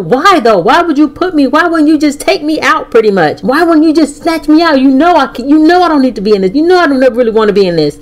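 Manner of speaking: mildly reenacted angry yelling, rushing at the end; mad yelling. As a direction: relive the anger, demanding answers. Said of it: "No, I'm still mad, yelling, still".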